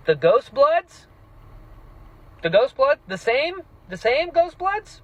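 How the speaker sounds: high, loud rising disbelief; stunned rising questions as it clicks; realizing the same faction; incredulous. Real, not acted. Shocked realization of plot link.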